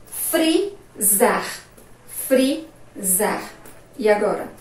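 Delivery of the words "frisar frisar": "Frisar" in two separated syllables; slow dictation. Shown as a demonstration. Teacher repeating a word for the class, slow syllables. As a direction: pause between the syllables as you dictate.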